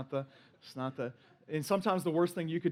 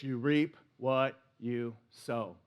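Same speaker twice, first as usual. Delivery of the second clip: slow, lower, weighty; solemn mantra; 6 semitones lower, slower than usual. Acted. Pastor gives the congregation his life mantra, slow and weighty. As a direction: let each word land slowly like a motto. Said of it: "This is my life mantra".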